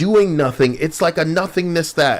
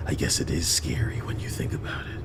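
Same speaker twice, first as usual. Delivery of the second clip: whispering; 12 semitones lower, 9 dB quieter, faster than usual. Real, not acted. Whispered aside.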